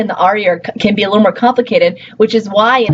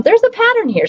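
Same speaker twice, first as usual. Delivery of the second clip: "there's a pattern here" much higher, bright and encouraging; upbeat emphasis; 13 semitones higher than usual. Shown as a demonstration. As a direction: lift the voice high on "pattern", reassuring the class.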